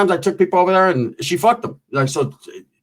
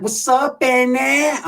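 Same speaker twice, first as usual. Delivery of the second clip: high, drawn-out mock greeting; wannabe northern chick; 8 semitones higher, much slower than usual. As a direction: imitate a woman posing as a homegirl. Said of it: "she thinks she's a, like, a northern chick".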